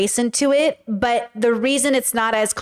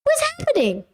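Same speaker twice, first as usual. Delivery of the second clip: very high comic squeak; acting out own bafflement. Showing how they scroll TikTok in bed, squeaky and baffled. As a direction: squeal it in mock disbelief. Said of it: "This is literally me on TikTok".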